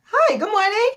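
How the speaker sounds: high, surprised oh hi; shocked greeting. Retold or imitated. Answering the guard's first greeting in surprise, high. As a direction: caught off guard, greeting back fast. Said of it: "I was so shocked".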